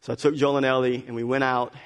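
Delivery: usual speaking voice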